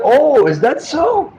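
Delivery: high, slow, drawn-out surprise; acted astonishment; surprised realization. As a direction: draw it out in high-pitched wonder. Acts devotee learning the truth.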